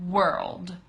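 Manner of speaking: word said in one quick go; brisk, compact model of the word; fast pronunciation. Shown as a demonstration. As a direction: say it at normal speed, no stretching. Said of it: "And then fast".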